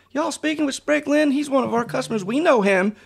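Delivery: high, insistent retold exclamation; excited boss imitation. Acts out boss's excited remarks.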